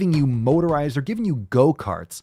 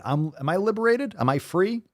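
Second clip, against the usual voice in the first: high, sarcastic questioning; rising, mock-amazed voice; mock wonder; 8 semitones higher than usual. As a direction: ask it in put-on hopeful disbelief. Mocks gratitude for being liberated.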